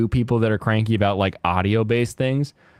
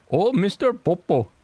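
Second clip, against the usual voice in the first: higher-pitched mock Indian accent; 8 semitones higher than usual. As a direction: put on a hammed-up Indian accent. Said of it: "making fun of the Indian people".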